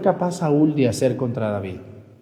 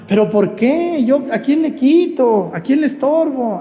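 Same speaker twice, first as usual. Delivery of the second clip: high-pitched mock protest of innocence; wounded innocence, pitched high; indignant protest; 10 semitones higher, 3 dB louder than usual. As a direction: plead high and indignant, who have I wronged. Protests at being envied himself.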